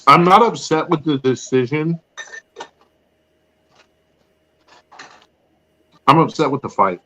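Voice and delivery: talking while chewing chips; wolfing down food. Real, not acted. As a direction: mouth full of chips, eating. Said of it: "so I'm eating chips".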